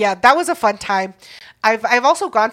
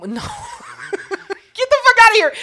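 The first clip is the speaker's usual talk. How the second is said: high, slow, through real laughter; laughing dismissal; 12 semitones higher, much slower than usual. Real, not acted. Host reacting to co-host's [name] guess, laughing dismissal. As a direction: wave off the joke while cracking up.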